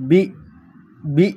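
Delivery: বি said with a short, pure ee; Italian letter B. Shown as a demonstration. Teacher models Italian B for the class, crisply. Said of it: "ইংলিশে বি এর মতোই".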